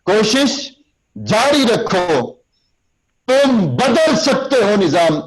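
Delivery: high, slow soaring exhortation; slow rousing oratory; motivating delivery. Shown as a demonstration. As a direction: lift the crowd with each phrase. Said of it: "ایسے ہی بولیں گے".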